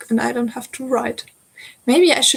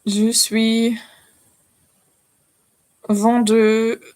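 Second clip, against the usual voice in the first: French accent, slow beginner's phrases; halting French accent, phrase by phrase; 3 dB louder than usual. Shown as a demonstration. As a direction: recite basic French with a careful French accent. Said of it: "my French accent is excellent".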